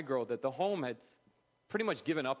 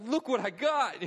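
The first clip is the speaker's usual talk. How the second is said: high, loud and beaming; a kid bursting with pride, high and loud; so happy; 10 semitones higher, 6 dB louder than usual. Retold or imitated. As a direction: show off the grade with delight. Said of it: "like, so happy".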